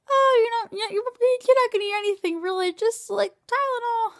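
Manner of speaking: high breezy mock surgeon; mocking the surgeon's reassurance. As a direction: brush it off sweetly as the surgeon. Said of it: "the surgeon's like".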